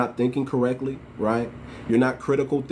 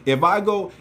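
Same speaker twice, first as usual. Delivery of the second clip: ordinary talk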